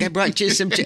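lightly voiced doting mother; Ann Mira offering. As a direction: hold out the soup sweetly. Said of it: "He's like".

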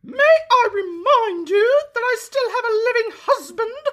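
very high mock-weepy wife. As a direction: squeal it like an offended lady, half-crying. Wife rebuffs king, voiced.

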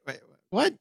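baffled disbelief. Acted. Host plays onlooker puzzled by the officer, baffled. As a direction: let it climb in quiet mock bewilderment.

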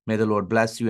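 ordinary delivery

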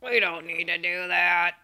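lightly voiced lazy inner voice, brushing it off; bitch voice. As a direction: talk yourself out of it in a lazy inner voice. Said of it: "my bitch voice is like".

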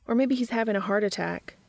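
ordinary delivery, nothing marked

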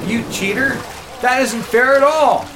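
mock outrage. Playful protest after losing race.

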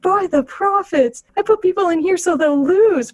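lightly voiced astonished Bajoran officer; stunned officer. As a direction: marvel at it in disbelief. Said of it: "he's like".

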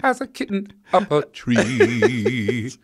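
slow, drawn-out crooner impression. Host revives an old singer impression, crooning slowly. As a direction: croon it slowly, stretching the last word. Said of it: "an old impression I used to do with [name]".

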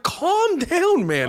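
high, exasperated plea. Retold or imitated. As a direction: talk a friend down from a freakout. Telling the friend to relax, retold.

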